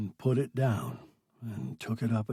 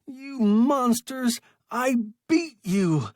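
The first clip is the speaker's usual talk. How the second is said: loud, much higher triumphant yell; triumphant taunting shout; 12 semitones higher, 7 dB louder, slower than usual. A narrator's reading. Stranded man yelling at his tormentors, gloating triumphant shout. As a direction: shout it at them like you finally won. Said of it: "He laughed harshly".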